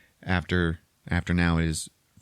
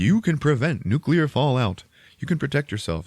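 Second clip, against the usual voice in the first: higher, mock public-service announcer; poster slogan voice; 6 semitones higher than usual. Reading out 1950s fallout shelter posters, mock announcer. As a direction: declaim it like an old propaganda slogan.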